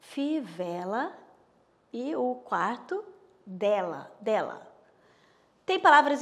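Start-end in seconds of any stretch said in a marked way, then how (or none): 0.0-4.7 Fivela drawn out slowly, then dela twice; slow demo. Shown as a demonstration. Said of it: "o segundo verso aqui".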